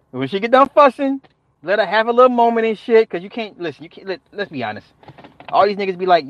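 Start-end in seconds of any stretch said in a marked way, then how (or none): none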